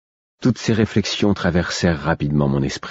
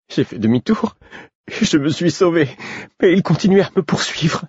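breathless, rising pitch toward hysteria; increasingly shrill; 4 semitones higher than usual. A narrator's reading. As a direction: voice climbing higher as panic builds. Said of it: "Sa voix devenait de plus en plus aiguë".

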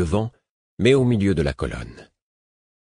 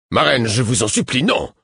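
higher, louder desperate plea; urgent pleading outburst; pleading cry; 5 semitones higher, 4 dB louder than usual. Acted. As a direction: beg her, rising to a cry on non. Guard begs queen to refuse.

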